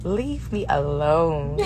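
"leave me alone", stretched slowly; slow, drawn-out. Real, not acted. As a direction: drag out each word. Telling fans to stop.

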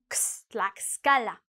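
a bare "ks", then "Tlaxcala" with the same sound; teaching "Tlaxcala" with the X as K plus S. Shown as a demonstration. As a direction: isolate KS, then say "Tlaxcala" carrying it. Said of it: "you have to have the sound".

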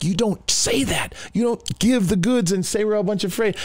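high-pitched indignant protest; mad. Retold or imitated. Tough guy silently objecting to the preacher giving away secrets, mad. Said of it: "but I'm mad".

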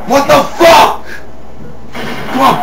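ordinary talk

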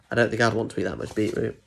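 ordinary delivery

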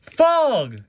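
high, loud cry of alarm; hammed-up storybook shout; yelling McQueen. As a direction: yell it high, drawn out. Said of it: "yelled McQueen".

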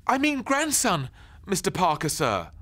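put-on high, loud flustered boy; backpedal, pitched high. Ben correcting himself to the neighbour, high and loud.

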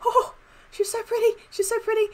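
high, breathless awe at something pretty; gushing delight. Real, not acted. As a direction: gush over it, near tears of joy. Gushing over new skin.

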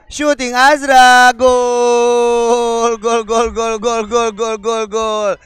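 excited goal call fading down. Real, not acted. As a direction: call the goal, then chant it. Commentator calls a goal. (seruan gol)

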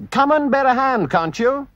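loud high call for help. Acted. Rat and mole shouting to Toad for help. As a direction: shout for him to come help. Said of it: "they cried".

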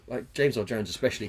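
ordinary talk

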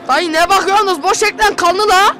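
loud startled yelling; shouting. Real, not acted. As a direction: yell at the screen in shock. Scared gamer yelling at screen.

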